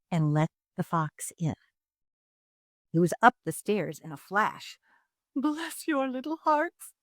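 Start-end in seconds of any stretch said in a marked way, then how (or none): 5.4-6.9 a hint of a fawning fox, higher and slow; crying out. A narrator's reading. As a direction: gush your thanks like a sly fox. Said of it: "he cried".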